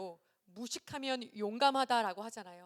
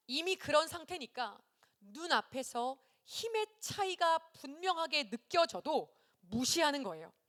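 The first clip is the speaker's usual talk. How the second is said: ordinary talk, nothing marked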